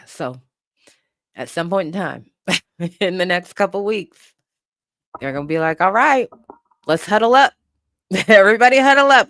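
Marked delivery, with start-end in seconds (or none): 5.8-9.3 raised, higher team huddle call; mock rallying call. Dog catchers gathering to plan the capture, mock rallying call. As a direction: call the team in, lightly acted.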